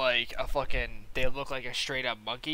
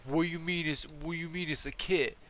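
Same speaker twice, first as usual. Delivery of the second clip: higher, puzzled mock dude voice; imitated customer; 4 semitones higher, 3 dB quieter than usual. Customer confused by the word kit, higher-pitched imitation. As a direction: put on a baffled customer, pitched up. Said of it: "this dude is talking like this".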